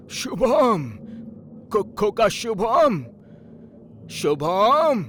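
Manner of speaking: loud, drawn-out high call. Acted. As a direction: call out for a missing child. Grandfather calling for missing boy.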